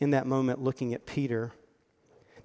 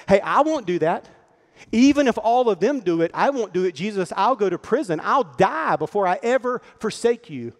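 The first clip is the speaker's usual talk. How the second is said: loud, higher, insistent vow; impassioned Peter; 5 semitones higher, 6 dB louder than usual. Acted. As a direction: protest fervently, voice raised. Peter boastfully protests to Jesus.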